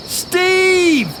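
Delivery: high, loud cries of alarm; screaming. A narrator's reading. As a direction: scream desperately to wake your brother. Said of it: "Jared screamed again".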